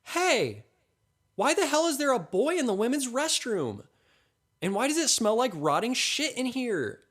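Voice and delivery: high-pitched outraged woman's shriek; shrieking yell. A narrator's reading. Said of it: "shrieks. She yells".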